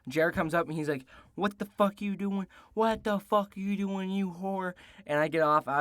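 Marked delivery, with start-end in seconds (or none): 1.3-4.7 hammed-up outrage at the cheater; retold angry yell. Boyfriend catching his girlfriend cheating, retold mock angry yell.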